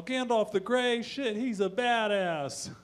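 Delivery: high, slow, loud admiration; a Tolkien fan hyped on Gandalf; gushing fanboy. Pretend. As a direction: savour each word like a superfan.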